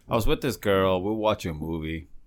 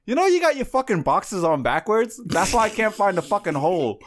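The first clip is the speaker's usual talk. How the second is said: high, loud mock complaint; pitched-up retelling of her frustrated outburst; imitating frustration; 12 semitones higher, 5 dB louder than usual. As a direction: act out an exasperated girlfriend. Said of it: "she gets frustrated".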